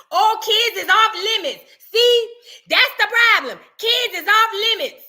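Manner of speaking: high, loud, slowed preachy mimic; hammed-up indignant commenter voice; mock outraged commenters. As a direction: lecture like an offended commenter.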